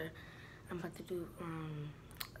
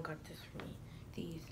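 soft unvoiced whisper; 3 semitones lower, 10 dB quieter than usual. Real, not acted. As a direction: drop to a secretive whisper. Showing mom's gifts, suddenly hushed.

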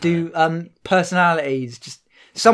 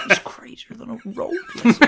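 Owen Wilson impression; 4 semitones higher, 5 dB quieter than usual. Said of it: "You do a good one".

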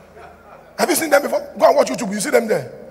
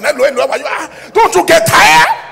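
mock phone calling; 7 semitones higher, 11 dB louder than usual. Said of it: "You just like to calling".

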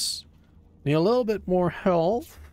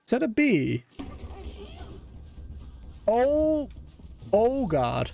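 startled; 8 semitones higher, slower than usual. Real, not acted. Spots a bee, startled.

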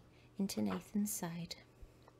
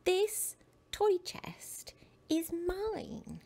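stubborn cry; 9 semitones higher, much slower than usual. Acted. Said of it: "he cried".

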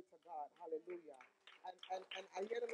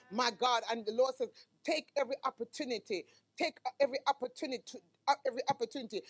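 emphatic preacher's delivery; insistent sermon voice; forceful preaching; 5 semitones higher, 14 dB louder than usual. Real, not acted. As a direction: preach it out, leaning on key words.

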